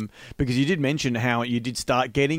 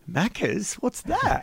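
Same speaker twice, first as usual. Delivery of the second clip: puzzled; 11 semitones higher, slower than usual. Retold or imitated. English drummer puzzled by slang.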